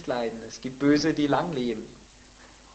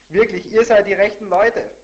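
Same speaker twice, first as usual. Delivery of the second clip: loud, high-pitched mock praise; cynical; 7 semitones higher, 12 dB louder than usual. Job mocking his friends' wisdom, loud and cynical. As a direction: declaim it with biting irony. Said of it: "wird zynisch".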